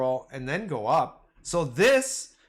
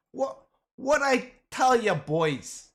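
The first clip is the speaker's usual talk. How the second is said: put-on Brooklyn accent; 8 semitones higher, slower than usual. Gloating, then mocks Brooklyn accent.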